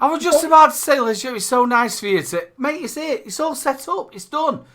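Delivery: high-pitched laughing exasperation; mock exasperation. Real, not acted. Host giving up on wrapping the show, high and laughing. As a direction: hand it over in comic despair.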